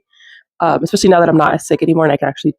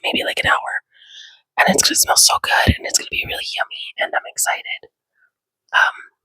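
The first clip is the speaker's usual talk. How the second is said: whispering. Real, not acted. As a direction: whisper it, people are asleep. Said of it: "I'm whispering because people are asleep in the house".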